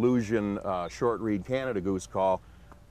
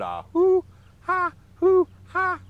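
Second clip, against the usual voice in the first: goose call hoots; 10 dB louder, slower than usual. Shown as a demonstration. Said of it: "I'm looking for a pure sound".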